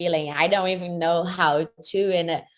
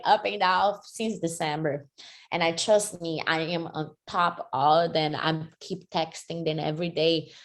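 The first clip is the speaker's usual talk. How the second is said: ordinary talk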